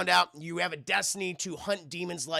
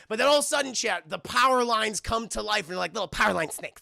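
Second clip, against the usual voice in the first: plain reading voice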